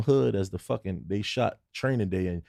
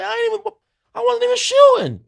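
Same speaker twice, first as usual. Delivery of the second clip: very high, louder squeal of denial; panicked protest; 7 dB louder than usual. Retold or imitated. Accused by a kid, panicked high protest. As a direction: squeal out your innocence. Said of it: "I'm like".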